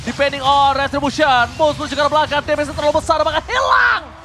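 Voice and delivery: rising hype shout; fighting game announcer. Real, not acted. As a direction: yell the play as it breaks. Caster shouting during team fight.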